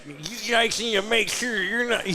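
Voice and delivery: put-on high, lisping old prospector voice. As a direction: talk like your tongue won't move. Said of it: "I sound like an old prospector".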